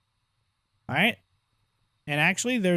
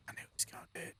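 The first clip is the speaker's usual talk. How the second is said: whispered grumble; 20 dB quieter, much faster than usual. Real, not acted. Mutters after being hit.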